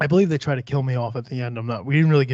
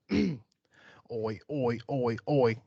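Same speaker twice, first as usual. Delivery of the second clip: put-on Australian accent; 6 dB quieter, much slower than usual. Warming up for the killer's song in an Australian accent. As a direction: repeat it to find the Australian accent. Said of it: "I lost me Australian accent".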